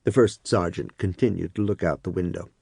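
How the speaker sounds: ordinary delivery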